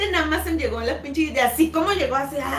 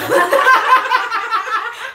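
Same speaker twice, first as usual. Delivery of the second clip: big real laugh breaking in, higher and louder; loud burst of laughter; 8 semitones higher, 9 dB louder than usual. Real, not acted. Cracking up while telling friends about the keys, loud laughter.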